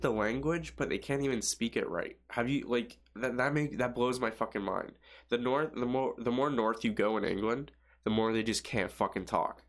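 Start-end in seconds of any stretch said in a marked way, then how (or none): none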